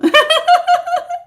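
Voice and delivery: high giggling laugh; real laugh before greeting a viewer. Real, not acted.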